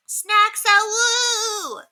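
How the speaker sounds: put-on high, bellowing kid's cry; bellowing child. A narrator's reading. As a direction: bellow it out at the bird. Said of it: "bellowed Cassiopeia".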